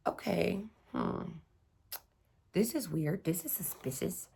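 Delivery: quiet, suspicious muttering to herself; soft uneasy self-talk; wary hushed thought. Retold or imitated. Noticing a car following her, hushed and wary.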